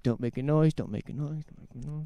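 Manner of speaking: mock nervous plea fading to almost nothing; nervous self-hushing. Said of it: "He would have been so nervous".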